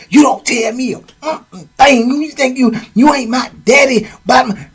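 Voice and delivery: high-pitched yelling retort; loud. Retold or imitated. Boo Boo yelling back at Charles, high and loud. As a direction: yell it back high and hurt. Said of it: "Boo Boo get loud".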